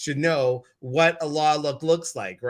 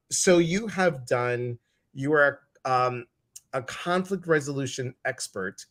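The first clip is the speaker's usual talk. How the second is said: ordinary talk, nothing marked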